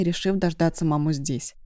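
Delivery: ordinary delivery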